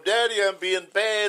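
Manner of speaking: put-on little girl voice. Daughter voiced in story.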